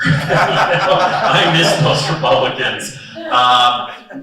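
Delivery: laughing. Real, not acted. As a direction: laugh while saying you miss them. Fond joke about old Republicans.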